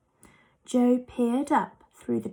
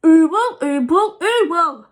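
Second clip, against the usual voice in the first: much louder, higher frightened ooble; frightened cry; 5 semitones higher, 10 dB louder than usual. A narrator's reading. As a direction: panic with a mouthful of toffee. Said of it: "cried Moonface in a fright".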